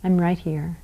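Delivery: quiet, tender reassurance; soft comforting. Shown as a demonstration. Parent comforting a child jammed in a corner, very softly. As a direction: soften right down, stay close. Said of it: "and you say".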